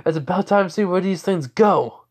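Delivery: high, loud, gung-ho line; eager battle cry. Acted. Hero jumping through the portal, eager battle cry.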